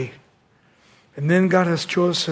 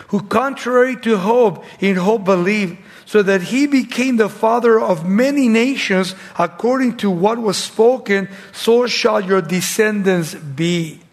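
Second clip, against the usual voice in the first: raised, ringing preacher's scripture reading; higher-pitched, declaimed Bible verse; 4 semitones higher, 5 dB louder than usual. Acted. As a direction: proclaim the verse to the congregation. Reads scripture aloud in preaching.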